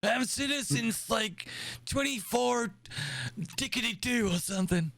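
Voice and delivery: put-on high, rambling old admiral; doddering old commander chatting. Plays old admiral character.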